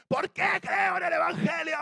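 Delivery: high, slow preacher's roar; evangelist's shout. Retold or imitated. Evangelist shouting a question at a huge congregation. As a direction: bellow it at two thousand people. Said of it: "pone una voz así y dice".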